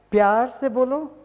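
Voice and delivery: प्यार से बोलो in a sweet, changed voice; loving tone. Shown as a demonstration. Teacher demonstrating a loving tone. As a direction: show how loving speech sounds.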